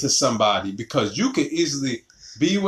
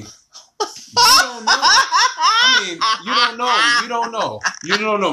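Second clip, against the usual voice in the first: laughing; 8 semitones higher, 5 dB louder than usual. Real, not acted. Laughing, teasing warning.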